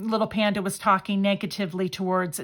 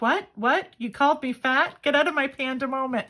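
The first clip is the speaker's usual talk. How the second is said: indignant panda; 6 semitones higher than usual. Acted. Panda card kicking out whoever called it fat, mock outrage. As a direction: play the offended panda, hammed-up and huffy. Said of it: "And then they're like".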